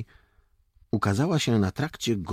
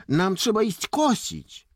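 put-on high, loud boyish excuse; defensive protest; 5 dB louder than usual. A narrator's reading. Jakub tells lieutenant they must mow, protesting. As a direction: object with voice pitched up. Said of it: "zaczął się bronić Jakub".

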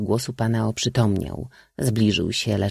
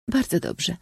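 high, absent-minded reply; mechanical princess; 9 semitones higher than usual. A narrator's reading. Princess answering the suitor without attention, high and mechanical. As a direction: answer automatically, pitched high. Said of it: "odparła księżniczka machinalnie".

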